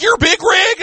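high excited rising question; amazed question. Retold or imitated. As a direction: squeak it out in amazement. Retold fan recognising him.

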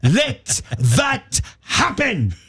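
high, hammed-up British hero declaration; British Spider-Man. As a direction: proclaim it in mock British, slowing right down at the end. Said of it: "if Spider-Man talked like that".

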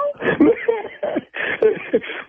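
shrieking high laugh at the reveal; high cackling laugh. Real, not acted. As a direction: cackle high at your own prank. Prankster reveals the prank.

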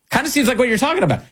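quick, high sarcastic jab; sarcastic aside. Real, not acted. Host mocking Cleaver's claim, quick and sarcastic. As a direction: rattle it off with sarcasm.

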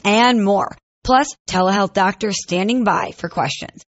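ad read. Acted. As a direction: sell it like a radio spot. Host reads a sponsor ad.

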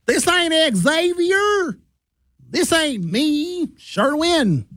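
very high, drawled mock Southern accent; bad Southern accent. Caller denies being Xavier on the podcast, badly faked Southern accent.

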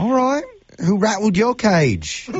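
hammy fake British accent; bad cockney-ish British voice. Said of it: "use my absolute worst British accent".

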